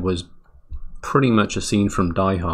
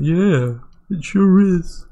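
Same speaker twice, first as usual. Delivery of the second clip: squeaky high, louder agreeing voice; high mock agreement; 11 semitones higher, 6 dB louder, much slower than usual. Agreeing with JP about the base, high squeaky chirp. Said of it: "I was going".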